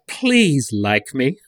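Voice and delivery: very slow, drawn-out plea, rising at the end; needy put-on voice. Spoof billionaire begging readers to like him, slow mock plea. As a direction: stretch it into a mock-needy appeal. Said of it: "I'm reading it in this voice".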